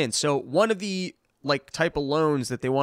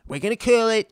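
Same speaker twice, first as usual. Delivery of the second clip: higher, louder, punchy boast; Trump boast; 6 semitones higher, 5 dB louder than usual. Retold or imitated. Trump boasting to a climate summit, loud and high. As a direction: sell it like a big promise. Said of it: "he goes".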